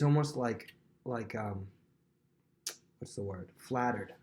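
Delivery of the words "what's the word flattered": normal talking voice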